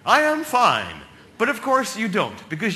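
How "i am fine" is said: loud, raised, confident call; yelling back. Acted. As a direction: shout back a composed all-clear. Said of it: "And you yell back".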